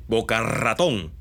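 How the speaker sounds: Boca ratón said slowly, the r in ratón rolled hard; strongly rolled r. Retold or imitated. Teacher imitating how Cubans in Miami roll the r. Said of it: "pronuncian la R con mucha más fuerza".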